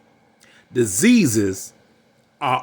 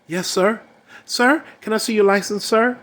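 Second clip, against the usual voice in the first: polite cop; 8 semitones higher than usual. Retold or imitated. Cops speaking politely to him.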